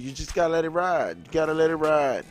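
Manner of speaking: ordinary talk, nothing marked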